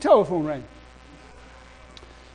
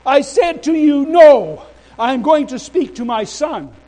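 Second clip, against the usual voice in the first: loud, raised, emphatic insistence; defiant refusal; 7 semitones higher, 9 dB louder, slower than usual. Retold or imitated. Minister answering police who arrest him, loudly defiant. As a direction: stand your ground against the officers.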